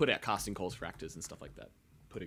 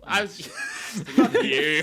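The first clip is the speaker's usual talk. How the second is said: laughing while protesting; 6 semitones higher, 9 dB louder, much slower than usual. Real, not acted. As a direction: laugh through the retort. Laughing at the joke.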